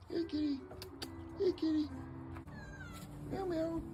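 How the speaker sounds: high, soft kitty-calling voice; small, very high pet voice. Real, not acted. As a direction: coax a cat over with tiny meows. Calling the cat.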